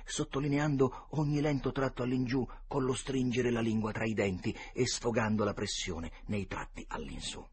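ordinary delivery, nothing marked

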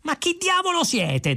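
high-pitched outraged question; exasperated high cry; indignant. A narrator's reading. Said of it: "domandò Fuchs indignato".

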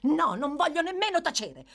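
loud, high refusal; shouting. A narrator's reading. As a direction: shout back, refusing to be silenced. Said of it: "rispose Nancy urlando".

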